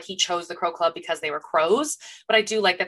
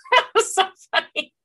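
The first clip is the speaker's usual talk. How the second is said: laughing; 11 semitones higher than usual. Real, not acted. Laughing recalling David's line.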